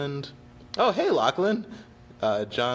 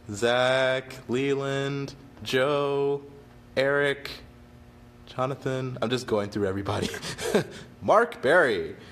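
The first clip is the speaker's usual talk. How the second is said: ordinary talk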